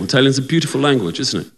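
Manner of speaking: ordinary delivery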